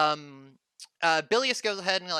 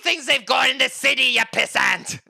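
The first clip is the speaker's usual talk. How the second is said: higher, louder mocking drunk voice; scornful barkeep; 5 semitones higher, 6 dB louder than usual. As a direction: sneer at the city folk's gadgets. Bartender mocking calculator.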